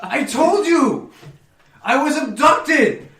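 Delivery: loud, slow, frantic excuse to an angry wife; Oberon's desperate protest. Acted. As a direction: insist on your innocence while dodging lightning. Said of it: "He goes".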